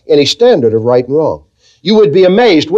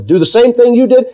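normal talking voice

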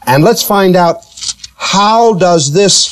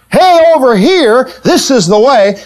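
higher-pitched warning call, easing down; voice calling from behind; 5 semitones higher than usual. Acted. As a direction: call out to steer someone back. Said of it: "we hear a voice saying behind us".

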